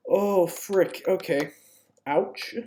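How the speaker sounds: ordinary delivery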